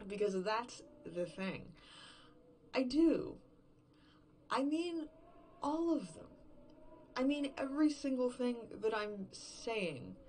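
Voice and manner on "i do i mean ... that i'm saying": higher and louder, flaring up in anger; angry outburst. Acted. Drunk woman confessing her anger about her partner, loud and raw. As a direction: blurt it out, furious and hurt.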